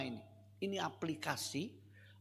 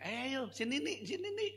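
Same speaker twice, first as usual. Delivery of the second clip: coaxing maid; 10 semitones higher than usual. Retold or imitated. Maid coaxing crying child.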